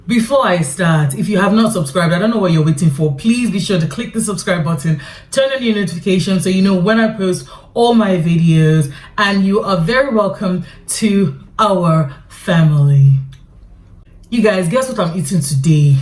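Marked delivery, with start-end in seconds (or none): none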